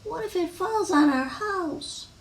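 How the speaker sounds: high little worrier's voice; acted childlike worry; anxious worrying. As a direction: fret in a small, high character voice. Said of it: "Wemberly worried about the tree".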